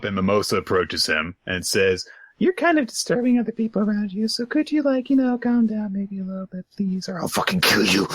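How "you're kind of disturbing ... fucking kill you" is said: high, put-on polite girl voice; mock-sweet falsetto easing down into menace; sweetly threatening girl. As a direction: speak high and dainty, dropping toward a threat. Voicing polite girl turning threatening.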